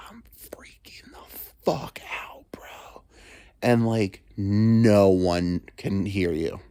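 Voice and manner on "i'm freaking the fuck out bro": high, slow, teary whisper; crying whisper; whimpering whisper. Acted. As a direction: whimper it out in a stoned panic. Imagined stoned panic.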